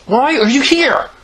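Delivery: high, slow, loud question; annoyed. A narrator's reading. Boy questions his tagalong friend, slow annoyed voice. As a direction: stretch it out in exasperation.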